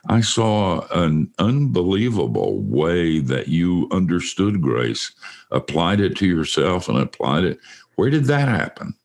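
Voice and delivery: ordinary talk, nothing marked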